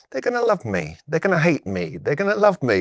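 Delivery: pitched well up, swinging between hope and dread; mock self-talk, voice jumping high; nervous self-talk. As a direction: replay the anxious pep talk you gave yourself. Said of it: "I talked to myself for two years straight at home being like".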